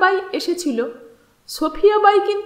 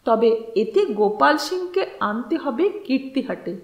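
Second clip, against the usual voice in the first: usual speaking voice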